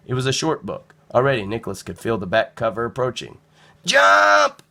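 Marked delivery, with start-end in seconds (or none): 3.8-4.6 high, loud cry; cried. A narrator's reading. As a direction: shout it joyfully as you leap. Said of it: "he cried".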